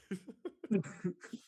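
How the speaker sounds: creepy villain laugh; clown cackle. Retold or imitated. Chuckles the Clown cackling after his joke. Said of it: "Chuckles the Clown".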